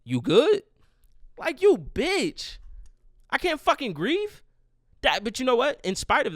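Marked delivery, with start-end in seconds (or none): 0.0-0.6 high, loud mock question, jumping up at the end; dad's clueless check-in. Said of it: "he goes like". 1.4-4.4 very high, indignant squawk; outraged retort. Retold or imitated. Outraged retort to tactless dad.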